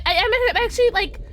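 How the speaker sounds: normal talking voice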